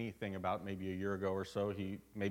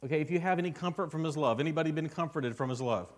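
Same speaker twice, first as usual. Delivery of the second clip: loud, rising call to the congregation; full-force preaching; 6 semitones higher, 7 dB louder than usual. Acted. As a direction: preach it out, louder and higher.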